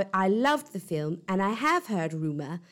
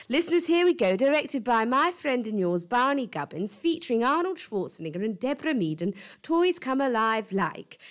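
announcer voice; 4 semitones higher than usual. Acted. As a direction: hype up the premiere like a host. Host introduces the trailer.

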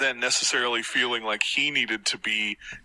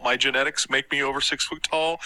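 ordinary talk, nothing marked

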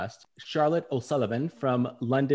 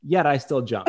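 ordinary talk

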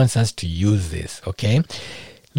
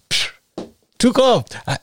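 ordinary delivery

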